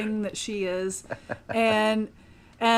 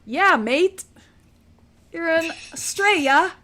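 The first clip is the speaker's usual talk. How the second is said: higher, louder Aussie voice; a broad Aussie "mate"; Australian accent; 7 semitones higher, 4 dB louder than usual. Retold or imitated. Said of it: "There we go".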